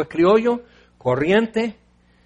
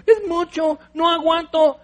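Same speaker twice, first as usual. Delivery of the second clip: high whiny mock complaint; Moses whining; 10 semitones higher than usual. Moses whines to God, high hammed-up whine. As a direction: whimper it like a fed-up child. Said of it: "Moisés ya empieza a chillar".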